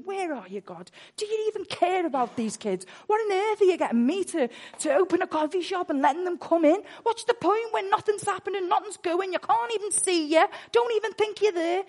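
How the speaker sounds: loud, high, desperate cries at God; anguished crying out. Retold or imitated. Preacher retelling how he cried out to God in loud anguish. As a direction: shout your despair to the sky. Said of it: "that's the angst I was in".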